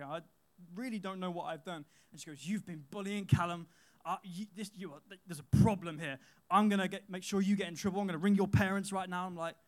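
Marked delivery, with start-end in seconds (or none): 2.5-9.3 loud, imitated angry scolding; angry teacher. Angry teacher accusing the boy of bullying Callum. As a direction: tell him off for bullying, loudly. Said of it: "She is so angry".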